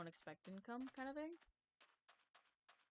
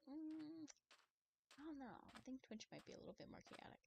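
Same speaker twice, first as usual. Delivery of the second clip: much softer, tentative disagreement; hesitant doubt; 6 semitones higher, 9 dB quieter than usual. Retold or imitated. Streamer answering what people are saying, hushed doubt. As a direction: disagree gently under your breath.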